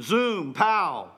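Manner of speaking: usual speaking voice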